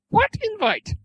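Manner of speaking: put-on questioning in a very high girlish voice; young girl. A narrator's reading. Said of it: "the young girl frowned".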